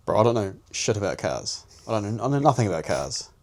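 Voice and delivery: ordinary talk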